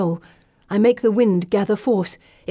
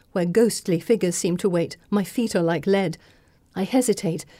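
ordinary delivery, nothing marked

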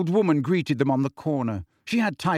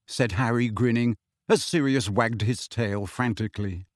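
ordinary delivery, nothing marked

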